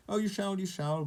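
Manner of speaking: high, teasing goblin promise; grinning. A narrator's reading. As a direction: say it through a grin. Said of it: "grinned the goblin".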